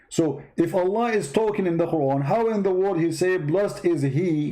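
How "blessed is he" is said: slow quote. Acted. Quoting the verse slowly.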